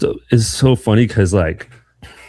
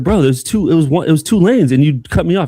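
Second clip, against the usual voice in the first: indignant complaint; 7 semitones higher than usual. Retold or imitated. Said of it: "I'm like".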